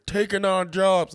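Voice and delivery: high, loud, drawn-out complaint; mock outraged yell. Co-host mocking anger at actors coming over here, exaggerated yell. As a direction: stretch it into a hammed-up high yell.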